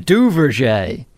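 lightly French-sounding Duverger; a hint of a Quebec accent; French Canadian accent. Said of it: "Sharon wants to know if that's your French Canadian accent".

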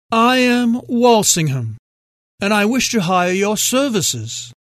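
put-on an elderly British collector, measured; British accent. A narrator's reading. Elderly British caller voiced.

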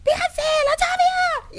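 very high, loud, drawn-out mock scream; imitated screaming. People yelling singers' names on red carpet, imitated screaming. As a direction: shriek the names slowly like red-carpet hecklers. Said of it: "they were, like, screaming at them".

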